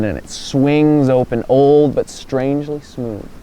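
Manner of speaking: slow, low narration with long pauses; suspenseful build. A narrator's reading. Builds to the reveal.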